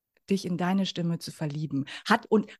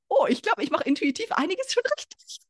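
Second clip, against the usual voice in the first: delighted realization; 9 semitones higher than usual. Retold or imitated. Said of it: "ich so".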